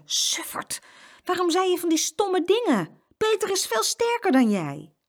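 very high, loud exasperated telling-off; breathed insult, then high-pitched scolding. A narrator's reading. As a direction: tell off her foolish brother. Sister scolds brother once alone.

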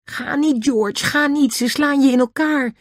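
very high, wailing plea; tearful pleading. A narrator's reading. Annie begs George not to go, tearfully. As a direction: beg in a high, crying child's voice. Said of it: "En hij begon te huilen".

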